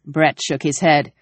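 usual speaking voice

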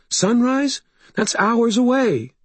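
high, dismayed voice; panicky protest. A narrator's reading. Sarissa hearing that help is hours away, panicky. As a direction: object high, in dread. Sarissa despairs at Harry's plan.

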